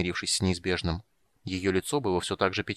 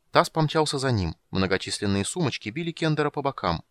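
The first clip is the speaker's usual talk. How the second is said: plain reading voice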